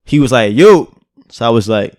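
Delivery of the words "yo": louder, raised retold hail; calling out. Stranger tries to get his attention, calling out. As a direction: imitate someone calling for attention.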